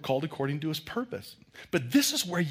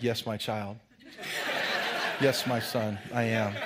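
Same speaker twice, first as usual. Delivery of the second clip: mock priest; 2 semitones lower than usual. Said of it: "Are you really a priest?".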